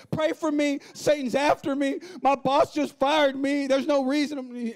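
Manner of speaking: high, loud, slow dramatic wail; mock panicked plea for prayer; whiny churchgoer. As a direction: ham up a melodramatic victim. Voicing a whining churchgoer.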